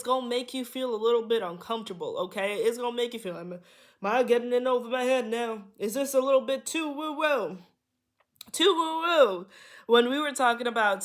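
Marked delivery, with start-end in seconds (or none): 4.0-9.5 higher, louder teasing aside, poking fun at herself; playful self-mockery. Real, not acted. Reader joking to viewers about being too woo-woo, playfully higher and louder. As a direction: tease yourself for sounding too mystical, pitched up.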